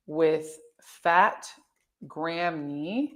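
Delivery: very slow, spaced-out words with pauses; dictating while typing. Real, not acted. Making a banner for the five P M chat, slowly dictated. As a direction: say it piece by piece as you type.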